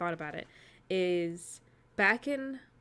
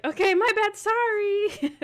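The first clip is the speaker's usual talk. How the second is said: high sheepish apology; 14 semitones higher, 6 dB louder, slower than usual. Retold or imitated. Retold awkward apology.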